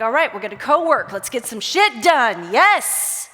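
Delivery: loud, eager, ready to work; rally yourself like a go-getter; pumped up. Retold or imitated. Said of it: "And I'm like".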